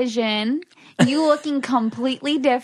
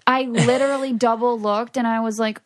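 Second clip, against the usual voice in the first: usual speaking voice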